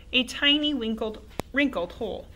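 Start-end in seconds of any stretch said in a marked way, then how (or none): none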